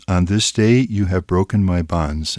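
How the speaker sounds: ordinary delivery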